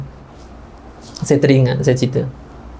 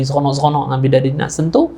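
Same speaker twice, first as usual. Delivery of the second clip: ordinary talk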